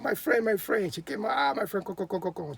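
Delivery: proud woman suddenly all welcome; eager welcome. A narrator's reading. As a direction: gush over him like an old friend. She now welcomes him eagerly.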